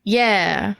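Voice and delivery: ordinary talk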